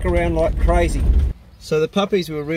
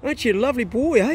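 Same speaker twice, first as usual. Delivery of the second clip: praising a dog; 9 semitones higher than usual. Real, not acted. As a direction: lift the voice and fuss over the dog.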